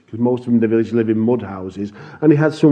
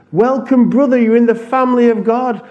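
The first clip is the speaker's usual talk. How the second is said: joyful welcome; 9 semitones higher, 4 dB louder than usual. Retold or imitated. Welcoming the new believer.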